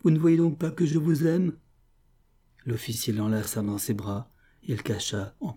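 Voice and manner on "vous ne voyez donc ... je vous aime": high, raised, pleading voice; anguished confession. A narrator's reading. Sobieslava confesses love to her enemy, anguished and loud. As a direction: plead as if through sobs. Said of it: "s'écria-t-elle en sanglotant".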